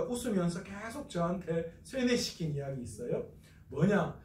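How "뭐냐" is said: ordinary delivery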